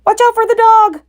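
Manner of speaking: very high loud bleating warning; sheep cry. A narrator's reading. Sheep crying warning about the dog. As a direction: bleat out the alarm. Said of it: "cried the sheep".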